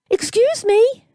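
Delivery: high, exaggerated posh camp mimicry; mocking posh camp accent. A narrator's reading. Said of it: "in an exaggeratedly posh, rather camp accent".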